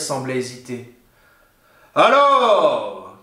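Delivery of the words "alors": louder, barked ogre call; a monster losing patience outside; impatient ogre shout. A narrator's reading. Ogre yelling at the hesitant witch, loud impatient shout. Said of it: "cria l'ogre dehors".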